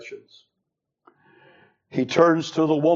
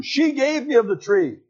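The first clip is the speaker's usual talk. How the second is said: she hit high, much higher; stress on she; 8 semitones higher than usual. Shown as a demonstration. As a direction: point at she. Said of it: "The word she is emphatic".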